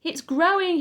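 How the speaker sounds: high, loud thrilled shout; excited yell. A narrator's reading. Boy watching the hen grow, a high excited yell. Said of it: "he yelled".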